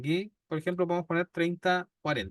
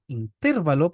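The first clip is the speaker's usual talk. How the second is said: in-TÉR-va-lo, punched louder on -TER-; stress on TER in intervalo; 5 dB louder than usual. Shown as a demonstration. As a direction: hit the second syllable of intervalo hard. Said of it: "para que fuese intervalo".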